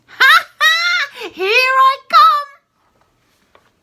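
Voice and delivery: very high, loud, slow laughing crow; gleeful old crone cackling; cackling shout. Acted. Grandma cackling at George as she bursts through. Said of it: "finding her voice at last".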